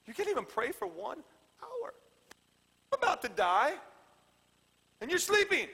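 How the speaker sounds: high-pitched, incredulous scolding; exasperated, voice leaping up at the sleepers; upset Jesus. Acted. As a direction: scold them in disbelief. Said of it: "he gets upset with them".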